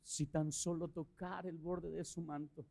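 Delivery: soft, slow, drawn-out lyric from a worship song; softly recited song line. Retold or imitated. Preacher recalling the song he cried out while prostrate, softly and slowly. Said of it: "Y era ese canto de".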